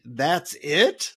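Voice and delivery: let-down disbelief, rising at the end; incredulous question. Acted. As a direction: question it as if badly underwhelmed. Disappointed seeker questions the guru.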